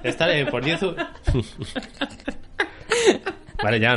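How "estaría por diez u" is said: hablar entre risas, voz más aguda; risa real. Real, not acted. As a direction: intentar seguir sin aguantar la risa. Intenta seguir entre risas del chiste. (hablando muerto de risa)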